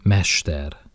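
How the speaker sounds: usual speaking voice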